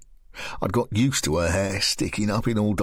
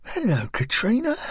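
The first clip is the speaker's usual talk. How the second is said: put-on higher, friendly young woman's voice; higher, coaxing woman's voice; Lisa's voice; 12 semitones higher than usual. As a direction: coax a strange girl gently. Narrator voices Lisa.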